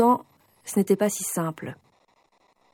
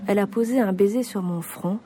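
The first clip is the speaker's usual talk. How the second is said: ordinary talk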